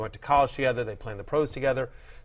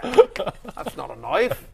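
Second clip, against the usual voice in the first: slow, higher mock Aussie drawl on knife; hammed-up Crocodile Dundee line, slow; very slow mock Australian, rising on knife; Australian accent; 5 semitones higher, much slower than usual. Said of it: "Trying to think Australian".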